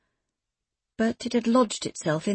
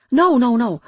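louder urgent denial; loud protest; 4 semitones higher, 8 dB louder than usual. Acted. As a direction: cut her off, insisting. Kaylee protesting quickly.

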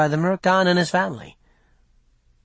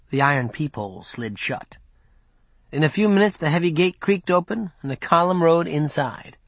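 ordinary delivery

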